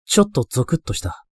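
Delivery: ordinary delivery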